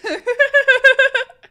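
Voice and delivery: loud, high squealing laugh; hysterical laughter. Real, not acted. Streamer losing it at own joke, high loud laughter. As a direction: burst into shrill, helpless laughter.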